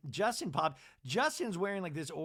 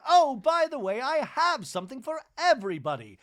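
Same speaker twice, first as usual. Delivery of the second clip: loud, higher mock cheer; excited announcement; 8 semitones higher, 9 dB louder than usual. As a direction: announce gifts to the whole group. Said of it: "she's like".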